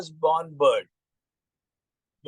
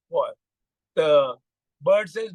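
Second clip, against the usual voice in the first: ordinary delivery, nothing marked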